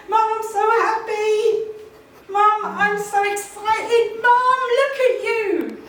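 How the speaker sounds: high, loud, slow, near singing; excited child. Retold or imitated. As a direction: squeal like an overjoyed eleven-year-old boy. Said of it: "He was celebrating".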